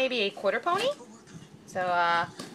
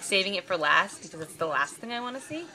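ordinary talk, nothing marked

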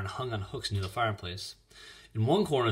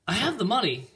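put-on high, insistent girl's voice; louder, high-pitched appeal; urging; 12 semitones higher, 5 dB louder than usual. A narrator's reading. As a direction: press him eagerly, pitched up. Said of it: "Ellen urged him".

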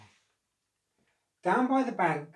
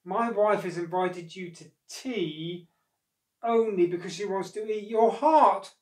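anguished confession; 5 semitones higher, 7 dB louder than usual. A narrator's reading. Crocodile confessing wife's plan to monkey, anguished. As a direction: let the awful truth burst out. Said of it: "he could take it no more".

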